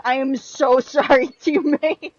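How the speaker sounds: loud, high laugh through sorry; laughing apology. Real, not acted.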